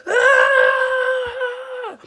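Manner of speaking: high, loud imitated battle cry; hammed-up rage yell; mock windmill scream. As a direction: scream as if charging in with fists flying. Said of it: "windmill, like".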